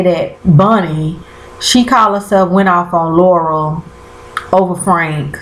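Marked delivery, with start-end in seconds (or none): none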